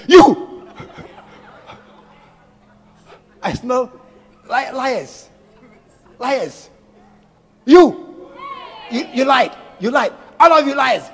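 loud, mock-furious accusation; accusing shout. Punishing God accusing the congregation of lying, mock-angry shout. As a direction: bark at the liars like a harsh judge.

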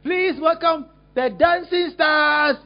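slow, loud announcing; slow loud stage welcome; announcer call. Retold or imitated. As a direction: announce them like an MC. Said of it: "Then we call".

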